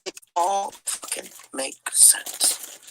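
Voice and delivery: slow, breathless unvoiced realisation; stunned whisper. Real, not acted. Vlogger realising the plot, in a slow stunned whisper. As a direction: let the truth sink in, barely voiced.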